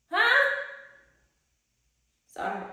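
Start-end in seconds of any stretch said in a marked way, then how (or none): 0.1-0.4 sharp high loud huh; mock challenge. Real, not acted. Vlogger challenging her viewers with a teasing huh. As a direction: throw a teasing huh at them.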